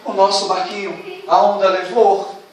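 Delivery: put-on low, soft, broken child's voice; tearful child. Child telling father the wave took the boat, quiet and sad. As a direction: let it sink, a sad little boy. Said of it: "Entra dentro de casa chorando e diz".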